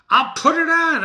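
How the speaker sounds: defiant insistence. Acted. Macbeth insists on armor.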